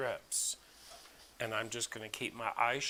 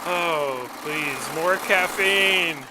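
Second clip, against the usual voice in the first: mock plea; 6 semitones higher, 10 dB louder, much slower than usual. Joking plea for more coffee.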